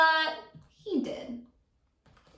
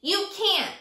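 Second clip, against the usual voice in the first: higher mock shout; 6 semitones higher than usual. Teacher as Sammy, shouting a refusal. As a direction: snap it back. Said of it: "Sammy shouted".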